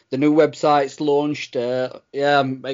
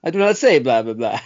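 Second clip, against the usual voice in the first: Transylvanian vampire; 2 semitones lower, 4 dB louder than usual. Retold or imitated. Co-host mocking the vampire from Hotel Transylvania, cartoon Dracula accent. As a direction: deliver it like a cartoon Dracula denying it. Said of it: "sounded like him off Hotel Transylvania".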